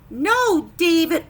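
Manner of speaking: loud, firm scolding; firm teacher's no; stern exclamation. Acted. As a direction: scold him like a strict teacher. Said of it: "an exclamation point again".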